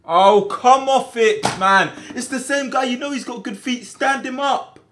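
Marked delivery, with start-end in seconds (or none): 0.0-3.4 exasperated shout. Real, not acted. Conceding a goal.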